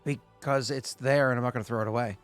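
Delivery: normal talking voice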